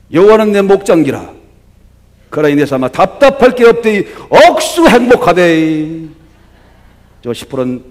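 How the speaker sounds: Psalm 23 read in broad Gyeongsang dialect; loud, playful Gyeongsang-accented psalm, swelling up; hammed-up Gyeongsang dialect recitation. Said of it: "경상도 버전으로 한번 얘기해 볼게요".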